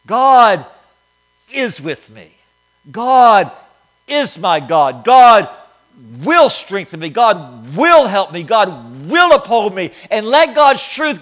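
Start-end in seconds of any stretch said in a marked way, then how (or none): 0.1-9.9 slow and firm, each God punched loud with a pause after; with conviction. Shown as a demonstration. Pastor showing the congregation how to declare it, with conviction. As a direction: declare it like you truly mean it. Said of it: "say it with some conviction".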